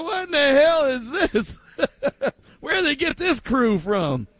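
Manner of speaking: imitating onlookers, high, through laughter; a baffled crowd reacting, laughing; laughing bewildered onlooker. Host imitating onlookers who are baffled by the crew, laughing. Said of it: "They would look at us and be like".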